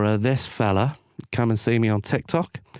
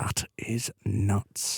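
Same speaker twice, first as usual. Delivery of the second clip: "That is nuts" stretched out slowly and softer; slow, drawn-out disbelief; 7 dB quieter, much slower than usual. Acted. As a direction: let each word of "That is nuts" hang, quieter. Repeats own reaction, drawn out.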